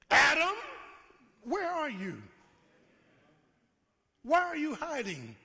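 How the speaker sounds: high, booming call; God calling into the garden; God calling Adam. Acted. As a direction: search him out with a call.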